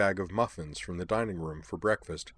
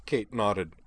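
plain reading voice

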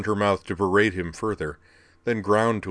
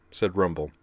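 ordinary delivery, nothing marked

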